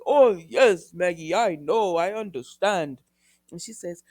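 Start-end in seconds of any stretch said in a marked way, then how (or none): 0.1-3.0 loud, slow, put-on syrupy understanding; hammed-up, drawn-out commiseration; mock sympathy. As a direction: imitate a con man oozing fake sympathy. Mocks Constantine's false sympathy.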